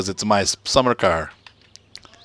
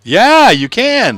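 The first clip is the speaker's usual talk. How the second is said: slow, high and loud yeah; a thrilled, stretched-out yes you can; drawn-out delight; 13 semitones higher, 11 dB louder, much slower than usual. Real, not acted. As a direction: savour the good news. Delighted the paint works.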